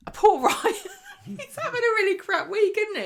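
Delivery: laughing. Real, not acted. Laughing sympathy for Ryan.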